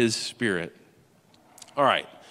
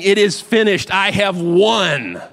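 high, loud, slow "I have won"; preacher voicing Jesus claiming victory, loud and slow; triumphant declaration; 6 semitones higher, 6 dB louder, slower than usual. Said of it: "He's actually saying".

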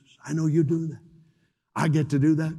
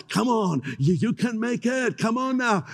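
urging, higher-pitched cheering on; zachęta; 6 semitones higher than usual. Acted. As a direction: coax them onward with raised pitch. Preacher voices God cheering believers on.

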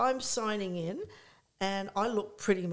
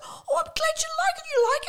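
very high, loud squeal; high-pitched mock delight; delighted gushing; 6 dB louder, faster than usual. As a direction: imitate a flattered, thrilled friend. Acting out delighted exchange.

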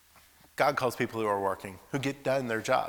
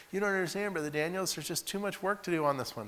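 higher, pleading excuse; whiny excuse; 7 semitones higher than usual. Retold or imitated. Student whining an excuse to Brother Daniels. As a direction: whine to get out of work.

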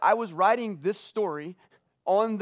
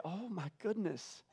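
soft and slow, voice catching with real emotion; choked up; 3 semitones higher, 11 dB quieter, much slower than usual. Real, not acted. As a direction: barely get the words out, holding back tears. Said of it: "I'm choking up right now".

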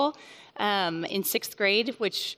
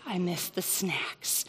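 hushed, slow admission of a mistake; a slow ashamed whisper; whispered confession; 3 semitones lower, 8 dB quieter, slower than usual. Real, not acted. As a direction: breathe it out like a guilty secret. Confessing a mom failure.

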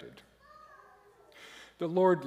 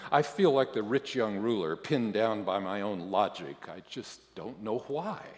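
ordinary delivery

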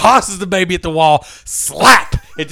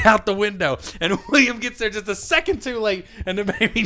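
usual speaking voice

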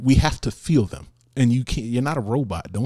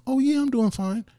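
very high, sing-song brush-off; mock cheery denial; fake fine; 14 semitones higher than usual. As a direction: pretend all is well, lightly mocked. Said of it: "be like".

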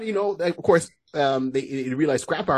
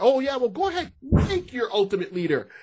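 mock open consent; 10 semitones higher than usual. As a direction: say it openly, pitched up for the others. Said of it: "and by himself is like".

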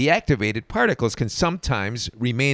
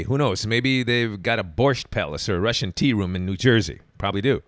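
usual speaking voice

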